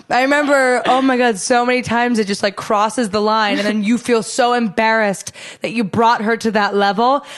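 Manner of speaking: ordinary talk, nothing marked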